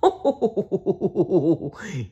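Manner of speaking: long high friendly laugh; goofy dinosaur laugh. Acted. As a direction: chuckle warmly like Barney. Barney laughing.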